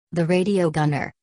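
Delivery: ordinary delivery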